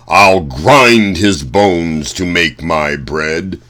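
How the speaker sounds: loud, slow booming giant chant; roaring giant. Acted. Giant sniffing for Jack in his kitchen, slow booming roar. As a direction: bellow it out like a hungry giant sniffing for prey. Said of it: "His roaring voice was just as scary".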